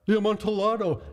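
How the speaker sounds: high startled exclamation; astonished cry. A narrator's reading. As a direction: blurt it out amazed. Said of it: "ejaculated my friend".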